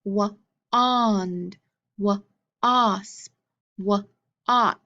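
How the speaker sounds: wond, wosp, woch with the middle ah drawn out; stretched ah in the middle. Shown as a demonstration. Teacher modeling words for kids to echo, ah drawn out. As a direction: lean on the ah sound in each word. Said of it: "What do you hear in the middle?".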